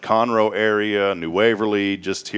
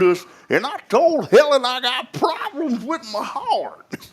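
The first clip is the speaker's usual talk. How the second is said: put-on much higher, very slow drawled old uncle telling a story; high slow Oklahoma drawl, milking it; hammed-up old uncle drawl; Uncle Bill impression; slower than usual.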